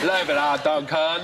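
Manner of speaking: slow, teasing copy of a bad English accent; mock his friend's English accent while laughing. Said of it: "Was that an English accent?".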